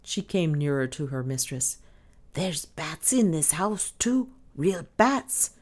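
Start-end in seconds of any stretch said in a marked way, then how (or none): 2.3-5.5 slow, drawn-out, voiced stage confidence; impressive hush. A narrator's reading. Maid warning mistress of bats, impressively slow. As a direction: stretch it out portentously, without truly whispering. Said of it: "she whispered impressively".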